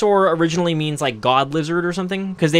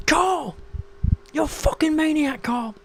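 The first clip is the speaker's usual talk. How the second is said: high-pitched mimicry; 12 semitones higher, 4 dB quieter than usual. Someone exasperated at Carl on the show, high squealing impression. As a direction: exclaim at Carl in a squeaky put-on voice.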